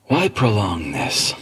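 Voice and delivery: slow menacing drawl; slow sinister voice. Acted. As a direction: menace them slowly. Villain line.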